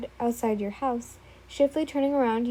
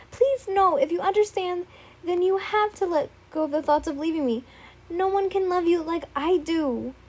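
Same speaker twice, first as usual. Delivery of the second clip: frantic pleading; 6 semitones higher than usual. Acted. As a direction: cut her off and beg. Izuku interrupting, desperate.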